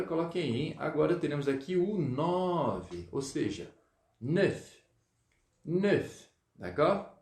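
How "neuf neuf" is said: neuf with rounded vowel and audible final F; neuf, sounded F. Shown as a demonstration. Teacher modelling nine for pupils, neuf with F. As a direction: say neuf as "nœf", F let out clearly. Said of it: "eu pronuncio o F".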